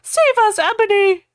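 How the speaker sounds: high, loud hammed-up cry; mock plea. Dumbledore begging Ebony for help, hammed-up. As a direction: wail it like a cartoon victim. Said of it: "Dumbledore cried".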